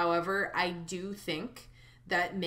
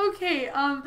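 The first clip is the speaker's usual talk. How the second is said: laughing; 12 semitones higher, 6 dB louder than usual. Real, not acted. Laughing-crying during the goodbye.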